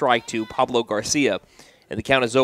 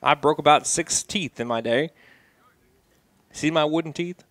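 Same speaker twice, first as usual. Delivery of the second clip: bad Texan accent. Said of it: "Bad Texan accent".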